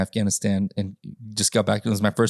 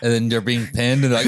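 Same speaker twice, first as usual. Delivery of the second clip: normal talking voice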